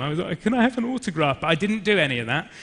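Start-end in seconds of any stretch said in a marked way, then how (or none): 0.4-1.4 high, mock-eager fan plea; excited fan. Actor imagines meeting a childhood hero, excited fan. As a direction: imitate an excited fan, pitched up and a bit louder. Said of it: "so sort of excited to see him".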